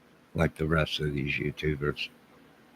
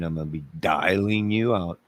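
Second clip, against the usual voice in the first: ordinary talk, nothing marked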